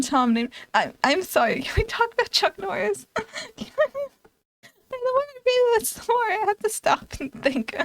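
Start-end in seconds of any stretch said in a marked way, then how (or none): 1.6-7.9 real high, squeaky laughing fit; helpless laughter. Real, not acted. Streamer losing it over Chuck Norris cameo, laughing helplessly. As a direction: barely get the words out through giggles.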